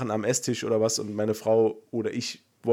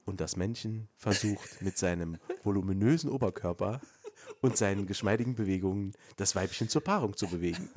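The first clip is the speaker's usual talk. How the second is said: low, soft documentary voice; nature documentary narrator; 5 semitones lower, 7 dB quieter than usual. Retold or imitated. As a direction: narrate like a wildlife film. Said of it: "so einen Sprecher wie in so einer Dokumentation".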